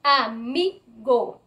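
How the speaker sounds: "Amigo" slowly split, with "mi" as the strong syllable; stressed penultimate syllable. Shown as a demonstration. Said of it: "penúltima sílaba de la palabra".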